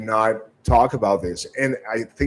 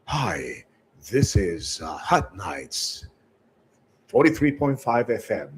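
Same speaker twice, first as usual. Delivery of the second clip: radio voice. Shown as a demonstration. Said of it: "So my radio voice".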